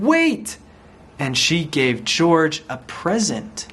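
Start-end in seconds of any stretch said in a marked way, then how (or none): 0.0-0.6 high, drawn-out plea to wait; urgent calling out. A narrator's reading. The nurse at the car, urgent high call. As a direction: call out to stop someone.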